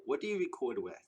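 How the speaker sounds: normal talking voice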